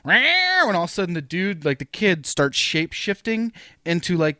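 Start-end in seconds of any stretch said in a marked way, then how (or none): 0.1-0.6 high, drawn-out mock meow; imitated cat yowl. Podcaster acting out the cat seeing the kid, imitated yowl. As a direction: screech it like an angry movie cat. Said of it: "the cat's like".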